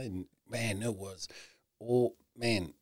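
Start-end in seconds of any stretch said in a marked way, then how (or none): none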